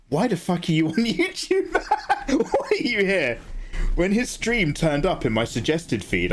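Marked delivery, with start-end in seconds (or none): 0.1-3.4 laughing. Retold or imitated. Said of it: "but I'm always just like".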